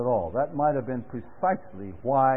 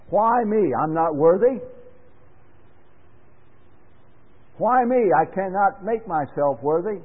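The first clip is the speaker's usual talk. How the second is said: anguished why me; 5 semitones higher than usual. Pretend. Voicing the why me plea.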